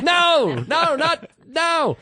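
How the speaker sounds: high, loud correcting shout; frantic shout. Retold or imitated. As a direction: yell no to take it back. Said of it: "I was like".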